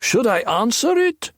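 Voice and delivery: shrill voice calling to his partner; shrill thug calling back. A narrator's reading. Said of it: "he shouted back".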